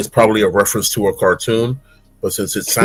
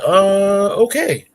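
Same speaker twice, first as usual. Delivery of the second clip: puzzled; 9 semitones higher, slower than usual. Retold or imitated. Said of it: "They'd be like".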